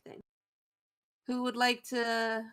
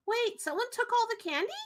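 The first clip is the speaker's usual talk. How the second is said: put-on high child voice, rising question; startled little kid needing an answer; alarmed kid; 10 semitones higher, faster than usual. Kid hearing of stolen candy, high and alarmed. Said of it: "These two kids".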